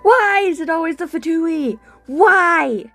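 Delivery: usual speaking voice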